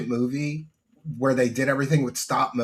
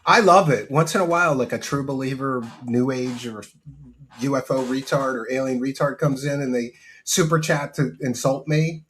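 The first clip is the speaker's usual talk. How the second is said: ordinary talk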